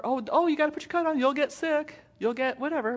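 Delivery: mock nagging parent. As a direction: play the worried parent at the tree. Mock fussing parent warning.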